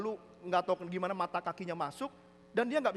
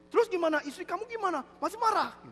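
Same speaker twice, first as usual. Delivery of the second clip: high, insistent acted questions; eager questioning; 12 semitones higher, 6 dB louder than usual. Deaf man quizzing friend about his wife, high and eager. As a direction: press the friend, pitched high.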